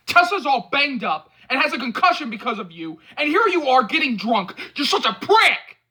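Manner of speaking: high, loud, rising to a scream; near scream. Acted. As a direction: let it build until you're screaming. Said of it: "He practically screams".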